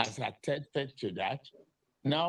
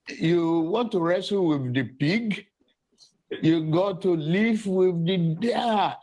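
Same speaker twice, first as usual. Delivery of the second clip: British-sounding accent; 5 semitones higher, 7 dB louder than usual. Real, not acted. Politician addressing press in British-tinged accent. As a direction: deliver the line like a proper British gent. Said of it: "Like a proper British guy".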